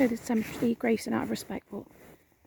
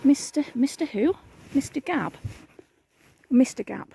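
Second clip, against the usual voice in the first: normal talking voice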